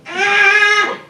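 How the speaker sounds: piercing high shriek of panic; scream like a baby. Retold or imitated. Storyteller reenacting his scream at the bear, high shriek. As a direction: let out a baby's terrified scream. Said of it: "I screamed like a baby".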